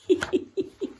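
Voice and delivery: quick high breathy laugh; a little chuckle after the ending; short laugh. Real, not acted. Laugh after the story ends.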